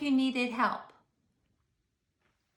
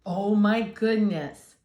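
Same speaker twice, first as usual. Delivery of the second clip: slow, loud, lower gasp of dismay; dismayed exclamation; 3 semitones lower, 7 dB louder, slower than usual. A narrator's reading. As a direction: sigh it out in mock alarm. Narrator exclaims aside.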